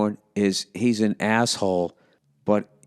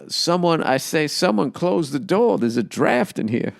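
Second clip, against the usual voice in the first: Foghorn Leghorn voice; 7 semitones higher than usual. Retold or imitated. Retelling Foghorn Leghorn calling the dog, high rooster impression. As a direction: ham up the blustering cartoon rooster. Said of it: "He's like".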